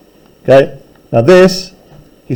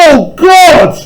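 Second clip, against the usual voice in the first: screaming in terror; 11 semitones higher than usual. Acted. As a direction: scream it in horror. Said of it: "I screamed".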